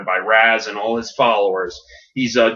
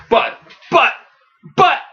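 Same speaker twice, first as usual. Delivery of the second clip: high, halting sputter; acted speechless protest; flustered stammer; 3 dB louder than usual. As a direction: stammer in disbelief with gaps between. Villain stammers, outwitted.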